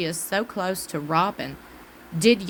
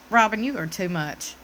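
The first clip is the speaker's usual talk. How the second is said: ordinary delivery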